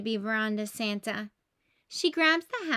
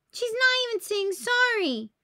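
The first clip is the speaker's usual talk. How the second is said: upset outcry; 10 semitones higher, 4 dB louder than usual. Acted. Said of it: "cries Bluey".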